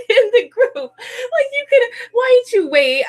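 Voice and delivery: high, laughing through the words; laugh-broken, high-pitched mock lament; laughing plea. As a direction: plead through helpless laughter. Laughing through retold plea to idol.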